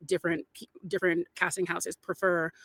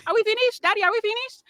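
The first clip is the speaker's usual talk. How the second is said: put-on high, loud little-kid Nigerian accent; childhood Nigerian accent; 6 dB louder than usual. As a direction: imitate a small child with a Nigerian accent calling to Daddy. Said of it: "I used to have an accent".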